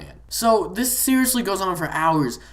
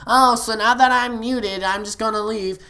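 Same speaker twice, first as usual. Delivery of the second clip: mock sulking player; 7 semitones higher, 4 dB louder than usual. Storyteller imitating the muted player leaving, high mock sulk. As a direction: imitate an announced huffy exit. Mimics player announcing his exit.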